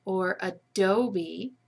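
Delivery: "adobe" said slowly with an "uh" at the start; "adobe" slowly, beginning with a schwa. Shown as a demonstration. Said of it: "the uh sound is at the beginning".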